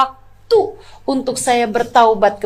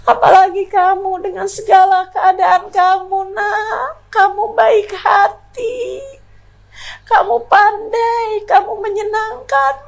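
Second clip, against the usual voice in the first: high, tearful mother's plea; crying apology; 12 semitones higher than usual. Retold or imitated. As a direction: sob out the apology to her daughter. Mother begs daughter's forgiveness. (ibu menangis memohon maaf kepada anaknya)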